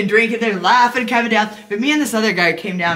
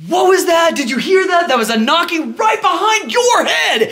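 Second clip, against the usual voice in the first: much higher and louder, alarmed and half laughing; imitating a spooked guest shouting with a laugh; startled shout; 9 semitones higher, 7 dB louder than usual. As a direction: blurt it out like you just heard a ghost knock. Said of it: "this dude".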